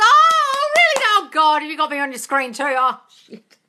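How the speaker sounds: high, loud shocked squeal. Real, not acted. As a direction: squeal in embarrassed disbelief. Shocked she's a screensaver.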